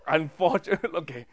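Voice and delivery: chuckling through the phrase; laughing. Shown as a demonstration. Teacher demoing the polite wording, laughing. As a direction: laugh as you say the polite word. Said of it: "Just say the word ugly".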